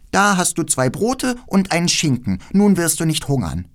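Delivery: hohe, lebhafte Mädchenstimme; freches Räubermädchen. Acted. Voices the robber girl. (keckes, forderndes Räubermädchen)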